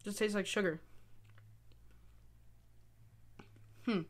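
chewing between words; talking while eating candy. Real, not acted. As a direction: mouth partly full. Seen 0: Candy put in mouth at -3; lips press and work while speaking.

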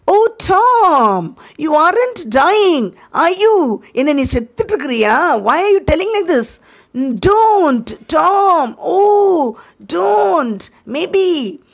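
put-on high, tearful boy's voice; frightened boy. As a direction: cry out afraid he's dying. Sid frightened Tom is dying. (பயந்து அழும் உயர்ந்த சிறுவன் குரல்)

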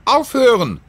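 very high, loud shout to stop them; a brave girl's piercing cry. A narrator's reading. Sophie steps in front of the children and cries out, loud. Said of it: "rief Sophie".

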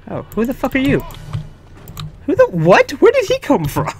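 high, loud, incredulous yelling; real shock at a guard popping up; startled outburst. Real, not acted. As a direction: blurt it out, caught completely off guard. Guard appears out of nowhere.

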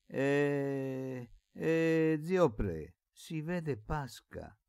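sly malice. A narrator's reading. Servant boy baiting the old man about Pasca, malicious. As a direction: needle him with near-spiteful mischief. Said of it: "disse con malizia, che rasentava la malignità".

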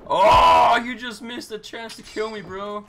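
high teasing yell at a near miss; excited shout. Real, not acted. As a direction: shout it out, gloating. Taunting teammate in game.

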